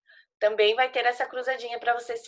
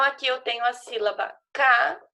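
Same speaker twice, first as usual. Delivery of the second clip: ordinary delivery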